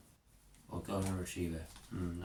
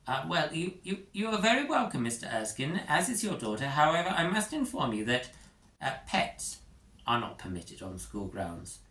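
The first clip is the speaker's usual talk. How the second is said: high, quick stammering officialdom; nervous teacher facing a trillionaire; flustered Prongle; 6 semitones higher than usual. Acted. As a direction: stumble then insist on rules. Flustered Prongle refuses dog.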